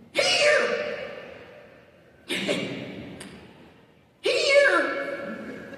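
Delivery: very high, gleeful calling voice; high cackling voice. Acted. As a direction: squeal it out gleefully. Genesis calls out gleefully.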